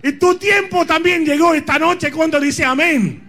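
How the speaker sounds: shouted, fiery sermon; preaching at full force. Acted.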